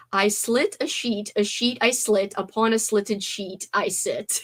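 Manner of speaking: slow, deliberate articulation; slow careful tongue twister. Shown as a demonstration. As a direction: enunciate each word slowly. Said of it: "family's favorite tongue twister".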